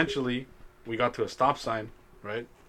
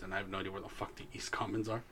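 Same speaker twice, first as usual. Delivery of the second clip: whispered aside; 3 semitones lower, 14 dB quieter, faster than usual. Real, not acted. Muttered aside while lost.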